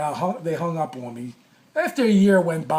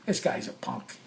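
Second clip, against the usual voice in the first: whispered scoff to himself; inner thought; 6 semitones lower, 10 dB quieter than usual. Retold or imitated. Scoffing inwardly at the request for a written apology, a whispered aside. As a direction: breathe it out as a private scoff. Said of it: "I said to myself".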